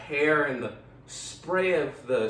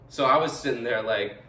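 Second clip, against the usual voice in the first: usual speaking voice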